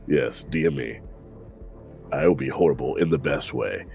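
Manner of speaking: ordinary delivery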